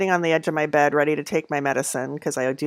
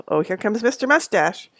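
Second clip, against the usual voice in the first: normal talking voice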